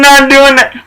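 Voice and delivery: high shout of refusal; yelling. Retold or imitated. Orville yelling at a worker to refuse. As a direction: yell the refusal at him. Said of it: "he's screaming at him".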